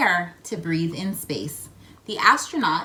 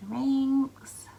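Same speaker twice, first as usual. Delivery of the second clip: breathy hushed word; whisper; 4 semitones higher than usual. Acted. Reader whispers for the children. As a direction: whisper it as a discovery.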